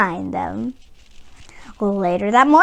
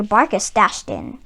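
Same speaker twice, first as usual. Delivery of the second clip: plain reading voice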